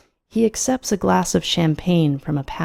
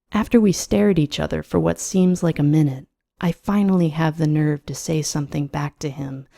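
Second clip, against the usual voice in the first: plain reading voice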